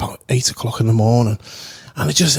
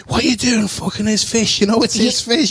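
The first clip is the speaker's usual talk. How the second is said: imitating an indignant landlord, much higher; high, scolding disbelief; outraged landlord; 8 semitones higher than usual. Landlord yelling at the brother over the fish, high and outraged.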